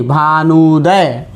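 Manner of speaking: भानुदय drawn out slowly, every syllable clear; teacher slowly reading out भानुदय as she writes it; slow demo word. Shown as a demonstration. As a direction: stretch भानुदय for the students. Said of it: "इसको लिखते हैं ऐसे".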